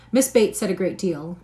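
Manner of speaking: ordinary delivery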